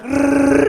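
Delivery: loud high mock Wookiee roar; loud high mock Wookiee growl; Chewbacca growl.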